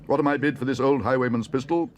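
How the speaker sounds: high, loud auctioneer; auctioneer's call. A narrator's reading. Auctioneer offering an old pistol, calling out. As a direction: call for bids across the hall. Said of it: "called the auctioneer".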